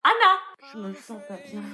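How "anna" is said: loud call up the stairs. Acted. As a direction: shout her name to wake her. Mother calling daughter awake.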